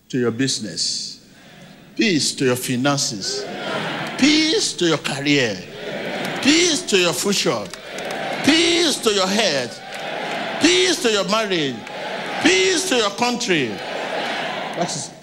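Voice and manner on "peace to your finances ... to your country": rising shout, pressing each blessing harder; building proclamation. Real, not acted. Preacher proclaiming peace, building.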